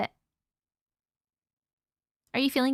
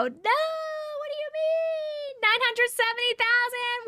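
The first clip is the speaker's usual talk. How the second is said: sung, much higher, stretched disbelief; shocked; 13 semitones higher, slower than usual. Real, not acted. Streamer stunned by a huge number, high sung cry. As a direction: sing out the number in delighted shock.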